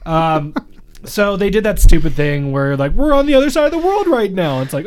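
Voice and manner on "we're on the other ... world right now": high, louder mock amazement; mock wonder. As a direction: gush like a fake-amazed tourist. Mocking contestants' fake surprise.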